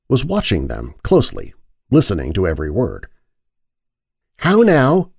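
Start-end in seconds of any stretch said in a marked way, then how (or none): 4.4-5.1 a sharp, loud, high challenge; rather loud. A narrator's reading. Isabeth loudly challenges the eavesdropping maid, high and loud. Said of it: "Isabeth said rather loudly".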